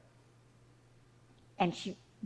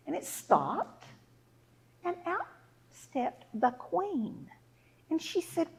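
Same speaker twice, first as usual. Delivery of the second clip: usual speaking voice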